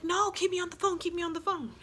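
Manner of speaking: high-pitched mock pleading; urgent plea. Best friend begging her to stay on the phone, high mock plea. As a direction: imitate a friend insisting in a high voice.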